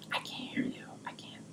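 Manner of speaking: hushed, voiceless whisper; imitated barely audible whispering. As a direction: whisper it as if your ears are covered. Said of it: "Why do you whisper?".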